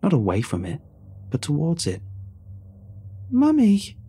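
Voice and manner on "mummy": put-on high, loud, delighted child cry; a little girl spotting her mother; bright happy call of a small child; happily. A narrator's reading. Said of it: "she said happily".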